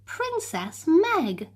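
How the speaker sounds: plain reading voice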